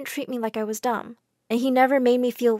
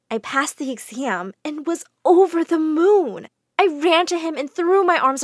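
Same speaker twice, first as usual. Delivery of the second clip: joyful; 5 semitones higher, 3 dB louder than usual. A narrator's reading. Joyful narration.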